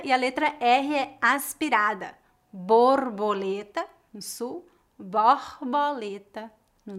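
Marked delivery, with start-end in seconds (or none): none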